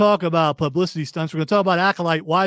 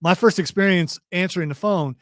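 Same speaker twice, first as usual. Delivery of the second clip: ordinary talk, nothing marked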